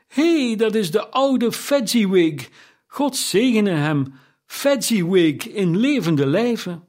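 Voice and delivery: high, excited outburst, slowing at the end; great excitement. A narrator's reading. Scrooge recognizing his old master, overjoyed and exclaiming. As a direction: cry out in delighted recognition. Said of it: "riep Scrooge in grote opwinding uit".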